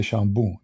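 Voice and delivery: shampoo said as "shambu", with a b for the p; Arabic pronunciation. Shown as a demonstration. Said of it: "We say".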